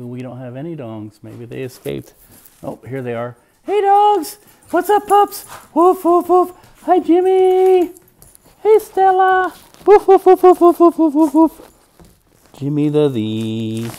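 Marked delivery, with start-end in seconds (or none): 3.6-11.7 very high, loud squeaky call to the dogs; excited pet-talk calling the dogs; high excited dog greeting. Real, not acted. 12.5-13.9 higher, louder pet talk; talking to the dog in pet voice. Real, not acted. Baby-talking to wiggling dog.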